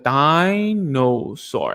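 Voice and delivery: very slow with big mouth shapes; slow exaggerated mouthing. Shown as a demonstration. Therapist showing the child only the mouth shape. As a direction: spell out the shape with your mouth. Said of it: "just give him the mouth shape".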